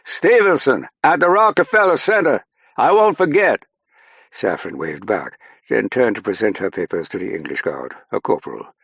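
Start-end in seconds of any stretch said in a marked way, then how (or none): 0.0-3.7 high, loud parting shout. A narrator's reading. Airman to Saffron as she passes the checkpoint, shouting. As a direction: yell it after someone walking away. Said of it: "Stackpole shouted".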